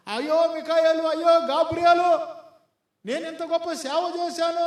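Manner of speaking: high, loud desperate pleading; crying out. Retold or imitated. A soul begging the angels, loud and high. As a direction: beg the angels at the top of your voice. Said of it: "అని కేకలేస్తుంటే".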